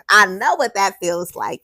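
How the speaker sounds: ordinary delivery, nothing marked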